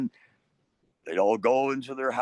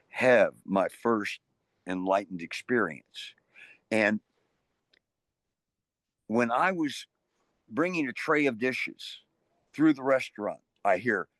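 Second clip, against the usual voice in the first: ordinary talk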